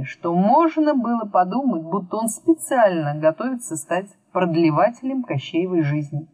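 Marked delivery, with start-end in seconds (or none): none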